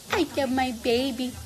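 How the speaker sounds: ordinary talk, nothing marked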